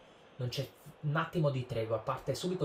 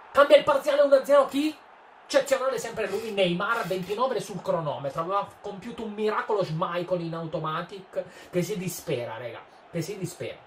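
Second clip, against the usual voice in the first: high, loud goal celebration; breathless italian goal commentary; 7 semitones higher, 7 dB louder than usual. Real, not acted. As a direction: shout the score change with joy.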